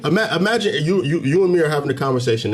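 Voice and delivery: ordinary talk, nothing marked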